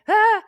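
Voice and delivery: high loud frustrated yell; frustrated mock scream. Inner critic screaming at the slow parade, high and loud. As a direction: let out a hammed-up cry of exasperation. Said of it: "everybody is behind like".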